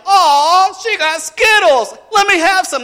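put-on loud, very high kid pleading; imitating excited kids yelling; clamoring brothers. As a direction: beg like envious little brothers. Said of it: "They started bombarding her".

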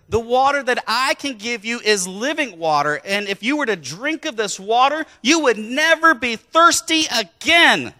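preacher's swelling proclamation of living water; proclaiming. Acted. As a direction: build to a ringing promise. Preacher proclaims Jesus' promise.

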